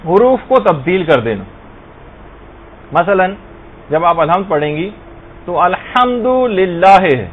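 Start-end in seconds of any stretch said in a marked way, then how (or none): 5.6-7.0 الحمدللہ slow, each sound drawn out clearly; الحمدللہ پورے مخرج کے ساتھ آہستہ; correct Alhamdulillah. Shown as a demonstration. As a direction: say الحمدللہ slowly with a proper heavy ح. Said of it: "جبکہ سے آپ نارملی لوگ پڑھتے ہیں".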